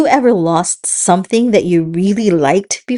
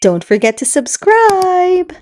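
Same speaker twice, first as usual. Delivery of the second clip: high promo voice; 10 semitones higher than usual. Acted. Inserted high promo line.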